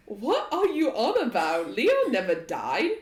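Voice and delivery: loud, high-pitched challenge; scoffing disbelief. Acted. Game master as Archeon disputing Leon's death, scoffing. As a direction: dismiss it as nonsense, loudly. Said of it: "laughs and he's like".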